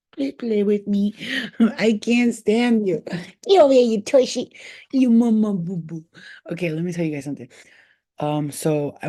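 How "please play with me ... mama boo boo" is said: voicing the cat. Owner voices her cat demanding play, cutesy and high. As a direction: play the cat begging for attention. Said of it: "She's like".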